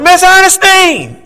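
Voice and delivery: a high, loud, slow call of the name; screaming a name. Retold or imitated. Neighbor screams for his mother at the door, a loud high call. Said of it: "she screamed in my door".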